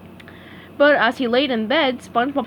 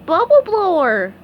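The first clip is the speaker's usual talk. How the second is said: SpongeBob voice; 7 semitones higher, 6 dB louder than usual. A narrator's reading. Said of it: "corrected SpongeBob".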